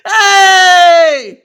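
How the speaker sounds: very loud, high furious yell; angry shout. Acted. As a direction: shout to stop the tantrum. Dad yelling at tantrum.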